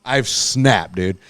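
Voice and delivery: ordinary delivery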